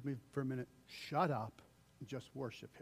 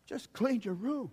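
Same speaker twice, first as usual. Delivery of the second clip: mock exasperated sigh then higher snap; huffing parent, half whispered then pitched up; 5 semitones higher than usual. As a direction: voice a fed-up parent. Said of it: "You're like".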